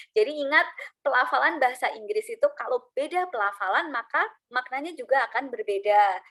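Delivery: ordinary talk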